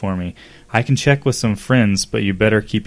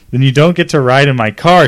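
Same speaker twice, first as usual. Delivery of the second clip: angry yell, louder; 4 semitones higher, 7 dB louder than usual. Acted. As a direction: shout her ultimatum. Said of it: "she yelled".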